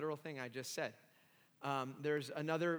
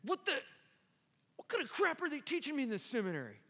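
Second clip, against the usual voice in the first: higher, half-mocking outraged voice; retold indignation; 10 semitones higher than usual. Young seminarian fuming over his textbook, mock outrage. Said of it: "And I began to get angry".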